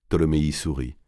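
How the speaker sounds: ordinary delivery, nothing marked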